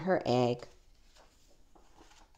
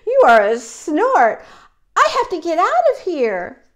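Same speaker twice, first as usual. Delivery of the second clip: high, loud storybook panic; frightened little bird, loud and high; alarmed baby bird; 7 semitones higher, 10 dB louder than usual. Acted. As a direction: cry out in mock alarm to escape.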